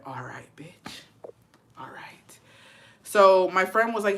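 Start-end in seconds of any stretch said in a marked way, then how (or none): 0.0-2.4 slow, breath-only whisper; hushed, drawn-out acted whisper; whispered warning. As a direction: whisper it like a quiet threat. Retold quiet warning to husband.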